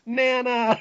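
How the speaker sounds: mock call, pitched high; imitated call. Fan quotes riffers' joke about old woman, imitated call. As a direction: call out the name in a high, drawn voice.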